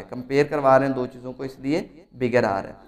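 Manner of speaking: normal talking voice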